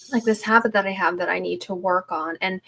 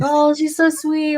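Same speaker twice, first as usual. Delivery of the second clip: higher, slow, sugary mock voice; mocking sweet view; 8 semitones higher than usual. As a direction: mimic fans cooing over how sweet she is. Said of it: "think I'm, like, a warm and fuzzy".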